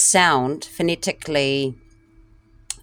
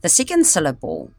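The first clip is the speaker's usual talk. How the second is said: ordinary delivery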